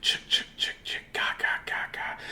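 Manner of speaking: voiceless creepy clicking chant; soft eerie whispered chant; spooky whisper chant. A narrator's reading. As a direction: whisper it like a ghost in the graves. Spooky graveyard noise.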